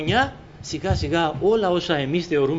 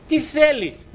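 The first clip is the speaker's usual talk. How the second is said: the Lord asking; 9 semitones higher, slower than usual. Retold or imitated. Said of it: "ρωτάει ο Κύριος".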